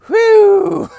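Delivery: high loud whew from the cold. Real, not acted. Reacting to the bitter cold.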